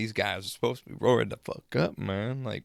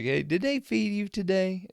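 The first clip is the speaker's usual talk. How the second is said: high-pitched imitated older man's question; Stanley; 11 semitones higher than usual. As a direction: lift the voice high, mimicking his question. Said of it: "he was just like".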